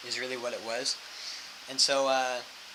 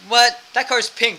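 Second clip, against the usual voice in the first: mock shock; 8 semitones higher, 9 dB louder, slower than usual. Mock shock about pink car.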